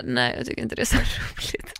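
whispered, laughing a little at herself; sheepish whisper. Retold or imitated. Patient whispers her fear to the nurse, sheepish whisper. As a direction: confess it in a hushed, embarrassed whisper.

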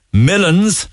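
"Millins", pitched high and teasing; a high mock "Millins" for millions; mispronounced millions. Shown as a demonstration. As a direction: tease him with "Millins". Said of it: "instead of millions".

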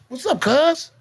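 high loud challenge; challenging cuz. Retold or imitated. Teen confronting mama's boyfriend, high loud challenge. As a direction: square up to him.

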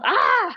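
hammed-up yell of frustration; frustrated cry. Fed up with her past self, mock-frustrated cry. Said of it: "I get really angry".

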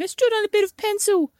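put-on high-pitched wounded kid voice; imitated crying child. Child with a bleeding foot explaining, imitated high upset voice. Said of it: "came in crying his head off".

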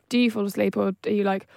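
ordinary talk

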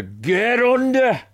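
high, drawn-out order to a dog; gruff-owner dog command. Shown as a demonstration. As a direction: send the dog off with a stretched-out yell. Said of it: "school of speaking to dogs".

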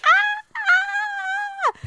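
very high, sustained mock shriek; mock drunken scream. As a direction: imitate a friend's long, silly scream. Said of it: "she just sits there and screams".

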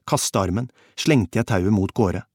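ordinary delivery

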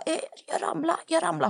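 high teary mock excuse. Caught naked, lying to the boyfriend's mother, high and pitiful. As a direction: squeak out the excuse like you're hurting.

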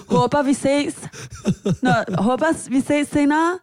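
hammed-up coward backing away; mock cowardly goodbye. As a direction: play the scared friend bolting with a chirpy bye. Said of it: "Så vil du bare være sådan her".

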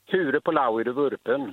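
slow, thick Smögen dialect. Shown as a demonstration. Caller repeating his test question for the hosts, Smögen dialect. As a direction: ask it slowly, as locals in Smögen would. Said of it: "kan du inte säga en gång till".

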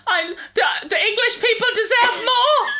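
high, laughing fake sob; mock tearful speech. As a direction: ham up a teary apology while laughing. Mocking a tearful politician speech.

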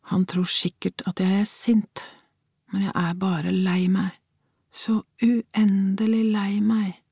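sad thought. A narrator's reading. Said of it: "tenkte hun".

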